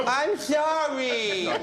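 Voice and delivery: very high, slow blubbering; cartoon boy crying in the car; crying Cartman. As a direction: sob it out like a sulking kid. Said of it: "and he's crying".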